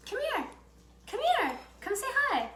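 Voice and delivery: very high sing-song pet voice; talking to a dog. Real, not acted. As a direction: coax the puppy over sweetly. Coaxing dog to come.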